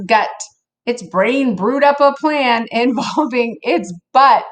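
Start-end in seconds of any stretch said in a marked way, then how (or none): none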